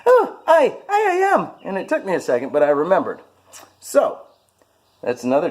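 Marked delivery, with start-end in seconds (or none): 0.1-1.5 high, loud put-on Irish accent. Caught by bar strangers, answering in Irish accent. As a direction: slip back into the Irish accent. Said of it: "you're that Irish guy".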